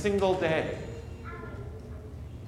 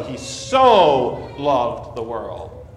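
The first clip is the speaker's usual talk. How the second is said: "so" drawn out, slow and weighty; stress on so; 7 dB louder, much slower than usual. Shown as a demonstration. Preacher demonstrating the Greek emphasis, slow stress on "so". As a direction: linger on "so" before loved. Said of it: "he stresses the word so".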